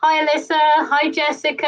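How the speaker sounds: ordinary delivery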